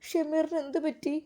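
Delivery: high, soft voice of a stunned woman; acted distress at bad news; shocked Seenath. A narrator's reading. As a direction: plead in tearful shock, haltingly. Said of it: "Zeenath ഒരു നിമിഷം ഞെട്ടിപ്പോയി".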